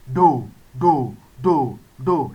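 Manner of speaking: "do" repeated low and steady. Shown as a demonstration. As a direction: keep "do" down on the low tone. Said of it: "that is do sign".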